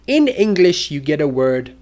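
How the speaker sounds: ordinary talk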